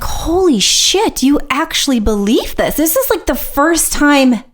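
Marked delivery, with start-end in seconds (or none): 0.0-2.8 stunned disbelief. Retold or imitated. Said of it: "And I was like".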